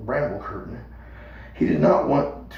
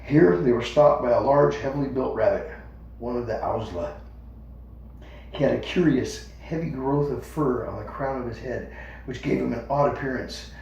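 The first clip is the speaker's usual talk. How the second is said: plain reading voice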